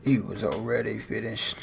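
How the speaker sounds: low, soft imitation of the narrator; cop voice. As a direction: echo the narrator in a low murmur. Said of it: "Gotta get a good cop voice".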